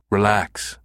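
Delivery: plain reading voice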